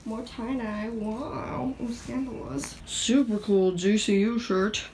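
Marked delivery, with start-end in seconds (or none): 2.8-4.8 slow, louder mock announcement; loud showoff. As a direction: announce it proudly and slowly. Showing off outfit item.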